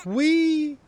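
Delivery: high, loud gleeful whee; excited squeal. Real, not acted. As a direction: squeal with delight. Sliding down, exclaiming.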